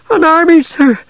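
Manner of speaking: put-on a terrified girl gasping out news; gasping farm girl. A narrator's reading. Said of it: "the young woman gasped".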